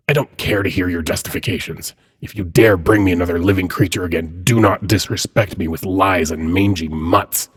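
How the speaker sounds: loud, forceful threat; angry warning. Acted. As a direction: lay down the law to an insolent underling. Threatening Cambion before dismissing him.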